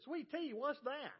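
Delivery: high-pitched, incredulous mimicry; baffled counter girl. Counter girl reacting to a sweet tea order, mock incredulous.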